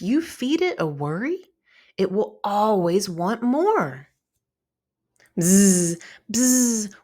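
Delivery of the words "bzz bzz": a loud buzzing bug noise through the lips; imitated insect buzzing. A narrator's reading. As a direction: buzz like a flying bug. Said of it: "Wentz heard as its noisy wings flapped".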